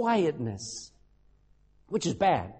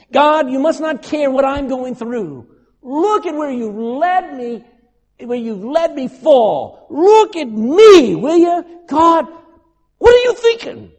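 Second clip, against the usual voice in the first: much higher and louder, slow, pleading; desperate outcry; 7 semitones higher, 12 dB louder than usual. Retold or imitated. Job pleading with a silent God, loud and desperate. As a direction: hurl the questions at heaven, slowly. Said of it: "Can you imagine him saying that?".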